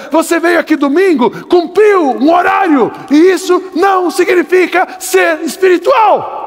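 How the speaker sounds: shouted sermon rebuke, the preacher insisting he is not angry; fiery preaching. Real, not acted. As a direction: thunder at them like a preacher in full force. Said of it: "eu não tô bravo".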